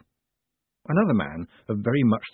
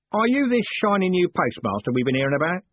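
a hint of a gruff coachman, higher; lightly voiced coachman; 9 semitones higher, 3 dB louder than usual. A narrator's reading. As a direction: challenge the newcomer. Coachman Jim questions Moist.

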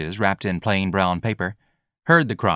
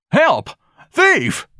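high, loud cries for help; alarmed shouting; 6 dB louder than usual. A narrator's reading. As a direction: holler for rescue from a thief.

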